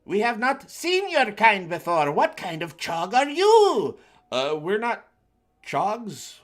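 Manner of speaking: alien bird creature. Acted. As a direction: greet the strangers like a booming bird being. Alien bird keeps speaking.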